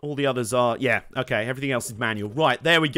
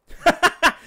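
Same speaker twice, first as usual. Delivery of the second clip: short high-pitched laugh; 12 semitones higher, 4 dB louder than usual. Real, not acted. Streamer amused at his wrestling talk, quick high laugh.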